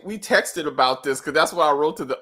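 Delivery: usual speaking voice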